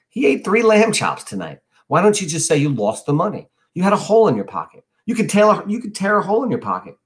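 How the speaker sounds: put-on high, quick kid-brother suggestions; eager reassurance. A narrator's reading. Younger brother inventing excuses for brother, eager.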